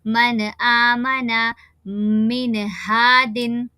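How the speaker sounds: मन आमना मिन हादिन slow, each नून distinct; clear noon. Shown as a demonstration. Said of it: "जल्दी पढ़ेंगे". (नून साफ़ ज़ाहिर करके पढ़ना)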